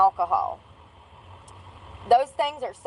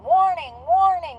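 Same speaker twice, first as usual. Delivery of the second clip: robotic alarm voice; 7 semitones higher than usual. Retold or imitated. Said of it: "It's like".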